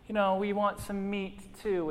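a touch of a whiny complaint; mild whine. Retold or imitated. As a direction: grumble it like a spoiled child. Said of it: "they whine and complain".

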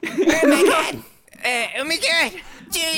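high cartoon-character cries of being slapped; South Park. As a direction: fake getting hit in a squeaky cartoon voice. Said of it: "You sound like a South Park character".

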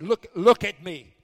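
escalated shouted order to the spirit; shouted command. Retold or imitated. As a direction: bark it at the spirit, slowly. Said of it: "I'm not talking to her. I'm talking to that spirit".

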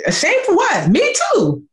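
very high, breezy shrugging tone; friend brushing it off. Retold or imitated. Friend saying me too, high and breezy. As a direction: dismiss the shame lightly. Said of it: "with Linda saying".

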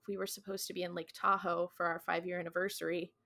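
softly wistful retelling; understated, soft wistfulness. Retold or imitated. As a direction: quote her with a touch of quiet longing. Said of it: "kind of wistfully talking about".

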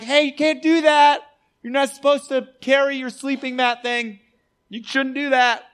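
high, loud mock outrage; mock scolding. Leaders objecting to a man carrying his mat, mock scolding. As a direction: call out a petty rule-breaker, hammed-up.

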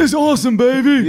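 high, loud, excited announcer voice; hyped sportscaster shout; Dick Vitale impression. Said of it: "You kinda look like Dick Vitale".

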